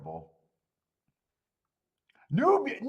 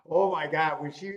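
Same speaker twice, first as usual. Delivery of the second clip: usual speaking voice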